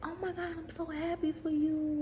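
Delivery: high mock gushing; hammed-up excited friend; gushing friend imitation. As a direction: gush like a thrilled friend. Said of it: "they'll just be like".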